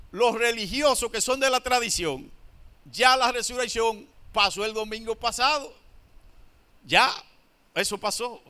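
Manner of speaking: ordinary talk, nothing marked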